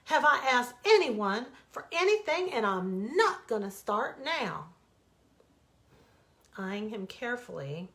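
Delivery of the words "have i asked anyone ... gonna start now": indignant. Acted. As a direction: dig in, refusing. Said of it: "he exploded".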